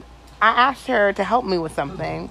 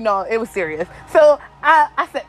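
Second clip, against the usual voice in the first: normal talking voice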